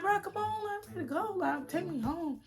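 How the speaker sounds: high, whiny plea to go home; whiny pleading. Retold or imitated. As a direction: beg him like a fed-up girlfriend. Pleading with boyfriend to leave.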